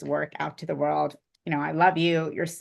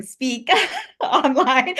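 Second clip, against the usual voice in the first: words breaking into a laugh; 7 semitones higher, 5 dB louder, much slower than usual. Real, not acted. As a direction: laugh at the memory. Laughing recalling first time.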